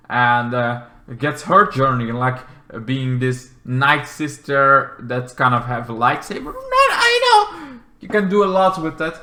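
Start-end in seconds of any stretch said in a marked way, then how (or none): 6.5-9.2 higher gushing voice; thrilled high remark; excited. Real, not acted. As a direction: enthuse eagerly. Gushing about Night Sister.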